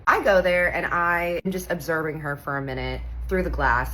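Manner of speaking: ordinary talk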